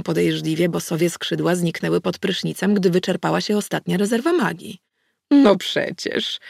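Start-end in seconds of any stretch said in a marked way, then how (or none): 5.3-6.4 put-on very slow, smug old she-wolf; smug goddess. A narrator's reading. Goddess smugly admits she watched the fight. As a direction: draw it out, pleased with yourself. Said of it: "zadowolona z siebie".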